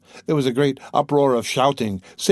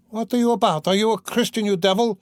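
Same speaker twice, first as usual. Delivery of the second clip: put-on much higher, indignant old man's cry; outraged shout; 9 semitones higher than usual. Old man in crowd scolding Mikolka, high outraged shout. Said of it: "shouted an old man in the crowd".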